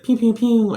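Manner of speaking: high, playful pew pew pew; gun sound effect. Retold or imitated. The speaker mimicking the show's action, high and playful. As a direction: fire off toy-gun sounds. Writer throws it back.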